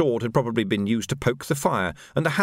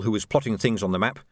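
ordinary delivery, nothing marked

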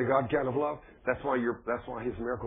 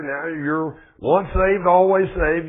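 slow, rising and louder; mocking slogan; 5 semitones higher, 8 dB louder, slower than usual. Preacher mocking a church slogan, slow and loud. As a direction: lampoon the slogan of some churches. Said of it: "You hear that in some churches".

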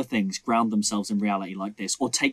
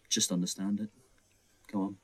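lower, softer, brushing the question off; dismissive film; 5 semitones lower, 10 dB quieter than usual. Retold or imitated. The film telling viewers to just accept it, dismissive. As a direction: shrug it off quietly. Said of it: "where they go".

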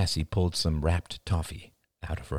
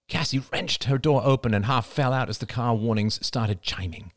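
ordinary delivery

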